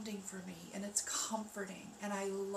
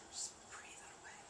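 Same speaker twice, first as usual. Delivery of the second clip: whisper; 13 dB quieter than usual. Real, not acted. Breathing guidance.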